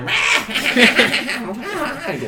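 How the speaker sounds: high, loud mock goblin cackle. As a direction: cackle like stupid little goblins. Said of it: "they're just cackling to each other".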